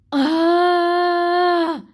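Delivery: loud, high cry of book despair; sudden high wail; anguished shout. Real, not acted. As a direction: let out a loud pained "ah". Overwhelmed by the book's ending.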